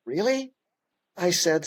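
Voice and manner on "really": much higher, rising really of disbelief; stunned disbelieving really; incredulous really. Retold or imitated. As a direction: stare and ask it in astonishment. Disbelief at the agent's reply.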